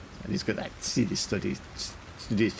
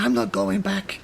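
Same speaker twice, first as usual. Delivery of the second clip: higher captain voice, refusing flatly; stubborn captain; 10 semitones higher, 3 dB louder than usual. Acted. Captain refuses to be dragged back inside. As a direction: dig your heels in as the captain.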